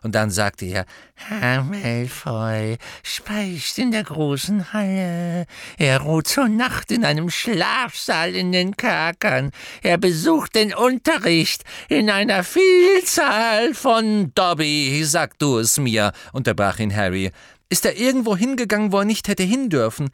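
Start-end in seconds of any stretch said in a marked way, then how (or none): 1.1-14.3 slow, higher, louder grudging croak; sulky elf. A narrator's reading. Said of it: "mit wütendem Gesicht". 14.3-20.1 higher, louder, fast; Harry questioning Dobby; brisk Harry. A narrator's reading. Harry voiced.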